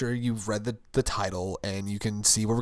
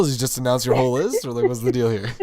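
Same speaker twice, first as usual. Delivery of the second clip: louder, higher mock exasperation at the list; mock exasperated; 4 semitones higher, 12 dB louder than usual. Teasing Mira about her list, loud mock exasperation. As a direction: call it out loudly, teasing her. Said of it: "I was like".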